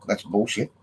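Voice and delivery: usual speaking voice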